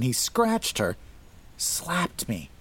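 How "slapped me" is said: plain reading voice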